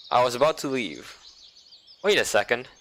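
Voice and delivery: ordinary talk, nothing marked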